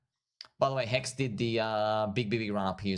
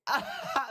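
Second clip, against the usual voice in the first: a high burst of laughter at the chart. Real, not acted. Crypto vlogger to viewers, a high delighted laugh. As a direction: laugh out high at how tiny it looked.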